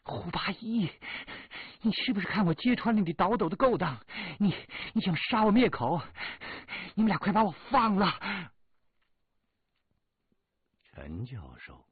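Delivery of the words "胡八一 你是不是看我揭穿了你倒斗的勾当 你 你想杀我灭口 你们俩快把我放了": high, loud furious woman's voice; through gritted teeth. Acted. Tied-up Shirley Yang accusing Hu Bayi, furious high shout. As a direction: scream it at him in fury while tied up. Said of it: "她咬牙切齿地说".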